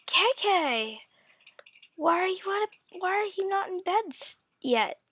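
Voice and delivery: high-pitched questioning mother voice; imitated mom. Mom finds child out of bed, imitated high-voiced questioning.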